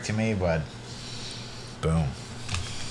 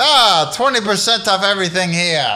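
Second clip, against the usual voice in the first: vampire salesman; 6 semitones higher, 3 dB louder than usual. Pretend.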